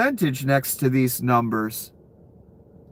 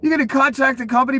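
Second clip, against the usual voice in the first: normal talking voice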